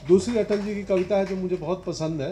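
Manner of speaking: normal talking voice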